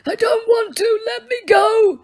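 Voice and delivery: high, strained pleading that breaks into a shout; old man shouting in terror; terrified begging. Acted. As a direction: beg like a frightened child. Poisoned old wizard begging.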